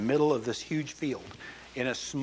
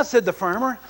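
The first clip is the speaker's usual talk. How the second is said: normal talking voice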